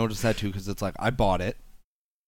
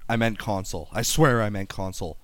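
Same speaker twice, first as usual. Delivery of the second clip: normal talking voice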